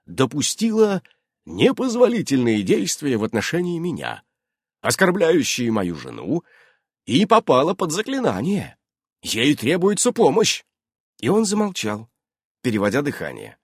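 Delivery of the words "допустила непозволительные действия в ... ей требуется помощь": raised, formal report; pompous statement in measured phrases; official tone. A narrator's reading. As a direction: recite it like an official complaint. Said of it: "официальным тоном продолжал Иннокентий".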